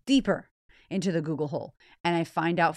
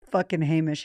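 normal talking voice

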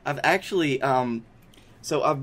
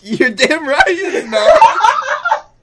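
laughing; 10 dB louder, much slower than usual. Real, not acted. Laughing at failed accent.

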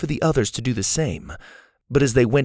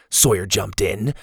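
ordinary delivery

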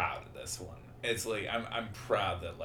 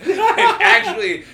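high squeal through laughter; laughing; 17 dB louder, slower than usual. Real, not acted. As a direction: laugh out the words, pitched way up. Bursts out laughing at own joke.

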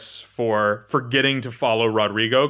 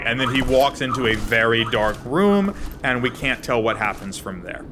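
ordinary talk

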